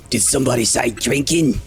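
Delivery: a little pirate tomato calls out; gruff little guy. Acted.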